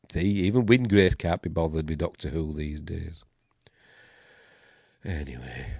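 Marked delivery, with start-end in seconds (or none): none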